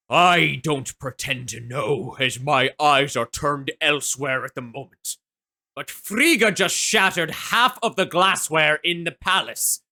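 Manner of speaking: a weary father god scolding gently; Odin's voice. Acted. Odin warns the players about his wife, lightly booming voice. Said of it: "You hear the voice reverberate once more".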